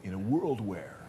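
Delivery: lightly imitated movie-trailer narrator; a hint of deep voiceover announcer; deep voice guy. Interviewer imitating the famous voiceover guy, mock deep announcer voice. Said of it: "that deep voice guy that could go".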